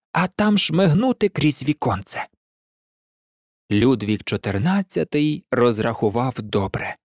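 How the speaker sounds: ordinary delivery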